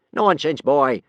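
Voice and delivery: loud, scornful snap; snapped. A narrator's reading. Gobber brushes off the frightened boy, snapping loudly. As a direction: bark it down dismissively. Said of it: "snapped Gobber the Belch".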